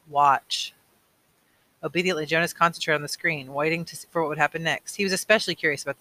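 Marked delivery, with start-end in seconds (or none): 0.1-0.7 strange commanding voice. Acted. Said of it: "commanded in a strange voice".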